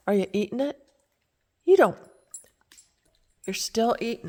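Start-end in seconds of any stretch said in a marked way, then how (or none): 1.6-2.0 surprised. Real, not acted. Reacting to dog eating.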